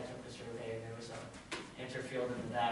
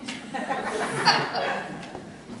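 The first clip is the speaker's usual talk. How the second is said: amused laugh; 13 semitones higher, 9 dB louder than usual. Real, not acted. Amused by board fights.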